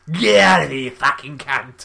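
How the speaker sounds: loud, hammed-up East End London shout; London accent. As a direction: bellow it in a put-on London accent. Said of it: "'Cause he's in London, so he's gotta be".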